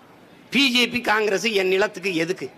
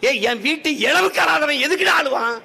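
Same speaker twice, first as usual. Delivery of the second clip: angry outcry; 7 semitones higher than usual. Acted. Angry rally outburst.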